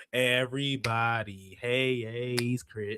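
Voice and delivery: low, slow, drawn-out TV narrator line; imitating the Everybody Hates Chris narrator; sitcom narrator imitation. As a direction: intone it like a sitcom narrator's voice-over. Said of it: "like that Everybody Hates Chris noise in the background".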